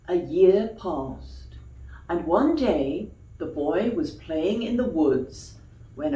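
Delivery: put-on gentle storybook reading for children; story narration. A narrator's reading. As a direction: tell it like a bedtime tale. Reads a bedtime story.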